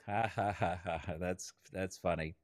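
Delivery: low, softening mock chuckle; retold chuckling along. Facilitator retells humouring the wise guy, low mock chuckling along. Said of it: "I chuckled right along".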